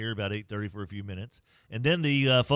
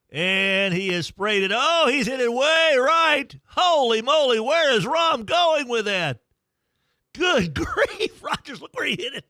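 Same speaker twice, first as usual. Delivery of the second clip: high, loud, astonished call; thrilled live golf call; excited play-by-play; 12 semitones higher, 6 dB louder than usual. Real, not acted. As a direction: react like the shot just sailed way right. Excited live call of bad shot.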